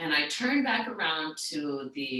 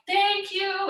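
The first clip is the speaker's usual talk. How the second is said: high, sing-song mock yell; held-back high call of thanks; retold yelling; 13 semitones higher than usual. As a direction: imitate calling down a store, pitch way up. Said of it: "I'm yelling down the store".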